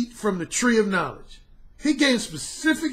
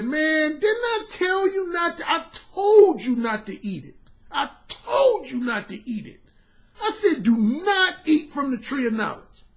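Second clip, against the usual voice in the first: angry God; 6 semitones higher than usual. Retold or imitated. Angry God scolding Adam.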